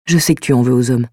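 subdued, lightly voiced letter reading; earnest apology, gently held back; apologetic letter writer. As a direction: read it as a sincere written confession. Reads the mystery writer's letter aloud.

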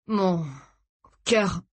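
breathless, words broken by a pause; halting gasp. A narrator's reading. Father having heart trouble, gasping. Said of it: "octa Tony".

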